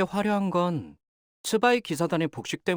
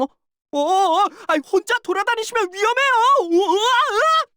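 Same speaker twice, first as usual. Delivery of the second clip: high loud panicked calls; frantic yelling, much higher and louder; alarmed shouting; 8 dB louder than usual. Acted. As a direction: shout after someone running into danger. Client wanders off, danger.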